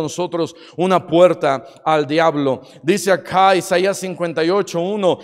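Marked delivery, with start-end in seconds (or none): none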